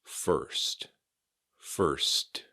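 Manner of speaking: "First" with the R, S and T all sounded; careful "First", every end consonant kept; crisp final RST. Shown as a demonstration. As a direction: say "first" as a careful model, ending on a clear T. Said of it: "The R S T at the end".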